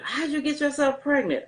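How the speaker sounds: high-pitched mild mock yell; retold yelling. Daughter imitating dad on the phone, mild mock yell. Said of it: "he's screaming and hollering over the phone".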